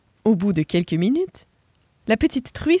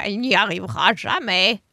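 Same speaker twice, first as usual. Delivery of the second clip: lightly voiced doubtful turkey; a scoffing turkey, lightly voiced; gobbling turkey. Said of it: "glouglouta le dindon".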